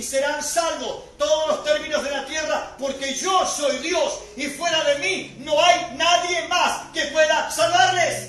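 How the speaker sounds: loud, slow full-force proclamation; preaching. Acted. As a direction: declare it to the whole congregation, drawn out and forceful.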